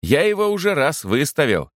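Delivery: high-pitched reply from another room; calling from the kitchen. A narrator's reading. Servant answers the chaplain from the kitchen, calling out. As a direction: pitch it up to carry through a doorway. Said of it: "донеслось из кухни".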